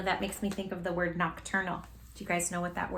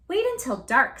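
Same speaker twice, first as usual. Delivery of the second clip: excitedly; 5 semitones higher, 5 dB louder than usual. A narrator's reading. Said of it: "Stella Luna said excitedly".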